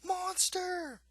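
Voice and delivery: high falsetto cry of alarm; mock yell. A narrator's reading. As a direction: squeal it like a held-back scream. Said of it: "Mister Green and yells".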